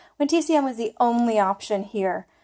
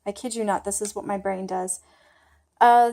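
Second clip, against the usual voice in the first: usual speaking voice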